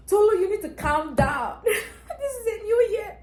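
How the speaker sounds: high, loud, laughing pep talk to herself; laughing self-scolding. Retold or imitated. Telling herself to calm down, laughing high. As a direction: scold yourself through laughter.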